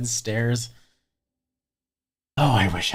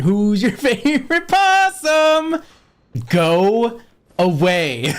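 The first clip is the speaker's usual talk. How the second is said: higher, slow, put-on cartoon voice breaking into laughs; a goofy drawn-out character voice; imitating a cartoon possum character; 7 semitones higher, 4 dB louder, much slower than usual. Quoting the movie to viewers in a high, slow cartoon voice. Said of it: "He's like".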